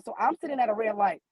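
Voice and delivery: normal talking voice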